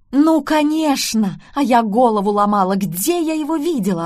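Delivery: mom lights up with discovery; delighted mom. A narrator's reading. Mom exclaims to daughter, overjoyed and loud. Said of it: "обрадовалась мама".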